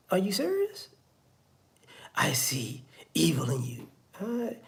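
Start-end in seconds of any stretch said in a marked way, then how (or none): 0.1-0.9 high, soft disbelieving question; incredulous. Acted. Accused person answers a wild accusation, incredulous. 2.1-3.9 low, whispery pronouncement; ominous mock accuser. Accuser claims to see evil in someone, ominous whisper.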